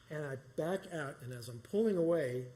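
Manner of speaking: ordinary talk, nothing marked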